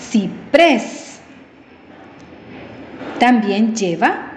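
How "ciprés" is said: "Ciprés" split in two, force on "prés"; stress on last syllable. Shown as a demonstration. Teacher showing the last-syllable rule, stressed "prés" in "Ciprés". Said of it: "la fuerza de voz en la última sílaba".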